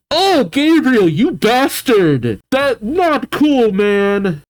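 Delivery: high, drawn-out indignant outburst; outraged scolding. Real, not acted. Reader scolding Gabriel for the letter, outraged.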